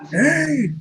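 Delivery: high, held call at ordinary volume; mock yell. Shown as a demonstration. Player shows how to get heard, high mock yell. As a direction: pitch it way up and hold it. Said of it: "Just yell really loud".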